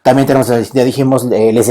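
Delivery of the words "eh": ordinary talk, nothing marked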